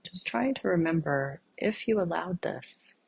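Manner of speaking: soft, unhurried meditation voice with long pauses; guided meditation. Real, not acted. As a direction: gently guide listeners inward, leaving silence between phrases.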